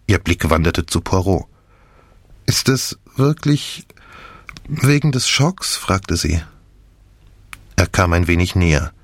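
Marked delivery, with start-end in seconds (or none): 2.5-5.8 slow, higher, uneasy questioning; shaken question. A narrator's reading. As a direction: ask haltingly, pitched up. Shaken patient questions Poirot.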